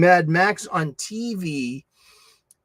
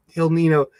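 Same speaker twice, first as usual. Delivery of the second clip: ordinary talk, nothing marked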